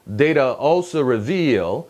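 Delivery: "reveal" with a strong second syllable, -VEAL; slow "Data also reveal", landing on VEAL; stress on reVEAL. Shown as a demonstration. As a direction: hit the end of "reveal". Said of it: "veal에 강세가 들어가죠".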